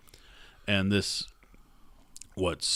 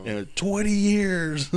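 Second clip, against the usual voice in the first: exasperated; 10 semitones higher, much slower than usual. Retold or imitated. Repeats coworker's exasperated line.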